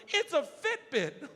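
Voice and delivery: high, slow, delighted brag; hammed-up thrilled winner; giddy announcement. Shows off prize to dads.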